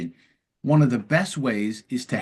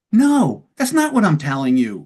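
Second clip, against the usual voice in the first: loud, high burst on the first word; indignant correction; 4 semitones higher, 5 dB louder than usual. Acted. Upset patient rejects a wrong paraphrase, indignant and loud. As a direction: push back hard at being misheard.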